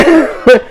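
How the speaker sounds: high real laugh; a sudden high laugh; burst of laughter. Real, not acted. Laughing at the snappy dog's reaction, high laugh. Laughing at her own story.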